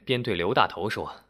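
ordinary delivery

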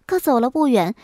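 usual speaking voice

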